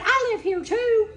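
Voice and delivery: loud, high, slow indignant protest; mock husband insisting he lives there too. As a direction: plant your feet and insist, loud and slow. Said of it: "he said".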